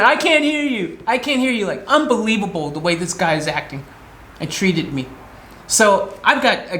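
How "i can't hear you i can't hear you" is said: mimicking the rude gas attendant; mocking attendant. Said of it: "still going".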